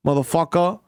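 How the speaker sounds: ordinary talk, nothing marked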